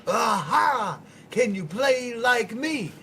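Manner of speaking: high, loud and slow, bragging holler; imitating a loud uncle hollering at his little nephew; loud mock uncle shout. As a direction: bellow it like a rowdy uncle showing off. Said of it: "he's like, he's like".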